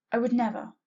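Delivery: loud instant protest; yelling. A narrator's reading. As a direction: blurt it out defensively. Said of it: "I yelled".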